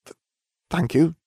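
high shaky stammer; trembling voice. Acted. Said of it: "voice trembling".

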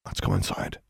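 breathless whisper, almost no voice; near whisper. A narrator's reading. Alexandra whispers to Anna to go inside. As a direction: whisper it low and scared. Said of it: "almost in a whisper".

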